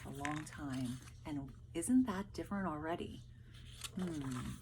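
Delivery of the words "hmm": ordinary talk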